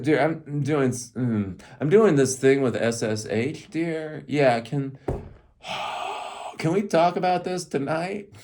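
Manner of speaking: high, strained, slow mock pleading; overloaded coder. Coder putting off wife's talk until tonight, acted overwhelmed. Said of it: "that's how I am when I'm doing tech work".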